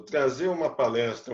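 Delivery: normal talking voice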